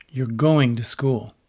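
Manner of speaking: slow, with weight on "going"; stressed "going", drawn out, then falling; insistent command. Shown as a demonstration. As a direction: insist on it, leaning hard on "going". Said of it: "sentence that signals insistence".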